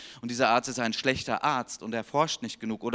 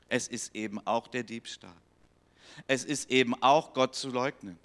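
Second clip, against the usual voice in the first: ordinary delivery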